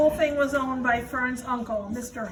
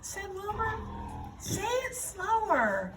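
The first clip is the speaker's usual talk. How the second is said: higher, puzzled piglet; young pig voice; 8 semitones higher, slower than usual. Acted. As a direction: ask slowly, a confused little pig. Wilbur's voice in story.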